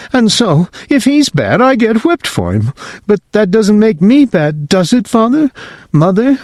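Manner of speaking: put-on high child's voice, pleading; little boy. Whipping boy asking his parents if he is bad, high child voice. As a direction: squeak it out like a worried small boy. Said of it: "Whipping boy".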